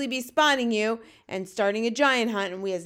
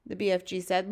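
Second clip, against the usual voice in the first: plain reading voice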